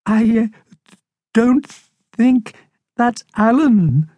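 high, halting hamster voice; timid Harold, frightened. Acted. Hamster sees a menacing shadow, high and faltering. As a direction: break it into nervous fragments.

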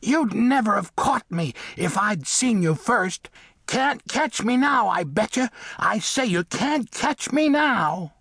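high, taunting little mole voice; contemptuous mole. A narrator's reading. Mole dares the cat to catch him, contemptuously. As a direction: taunt in a high small voice, defiantly. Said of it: "said Samuel contemptuously".

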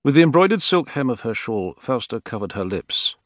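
ordinary delivery

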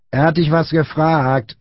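high, drawn-out pressing tone; bullying prompt. A narrator's reading. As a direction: press him menacingly for an answer.

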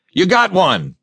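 high elated shout; excited cry. Acted. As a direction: cheer him on. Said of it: "Perdue cried".

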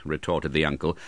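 normal talking voice

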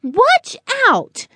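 very high, squeaky cry; squeaky warning. A narrator's reading. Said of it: "the loud voice said with a squeak".

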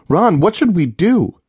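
begging her husband for help; frightened wife pleading; panicked. A narrator's reading. Leah panics.

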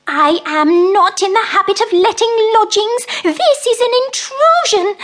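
high, loud, prim indignant mouse; huffy at the uninvited lodgers. Acted. Mrs Tittlemouse declares the bees an intrusion, high indignant mouse voice.

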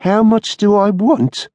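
high, drawn-out mocking echo; incredulous echo. A narrator's reading. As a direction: throw the question back scornfully. Blackmailer throws the question back.

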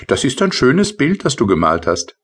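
high, light little sprite voice; tiny rain sprite. Acted. Tipsel's voice in story. (hohe, leichte Koboldstimme)

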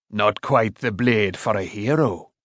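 slow, lightly voiced old smith; dry smith. A narrator's reading. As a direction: understate it slowly, in character. Smith's dry remark on ruined blade.

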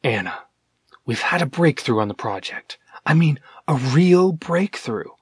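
hushed, secretive phone whisper. A narrator's reading. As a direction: breathe the news, barely voiced. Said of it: "then almost whispered down the phone".

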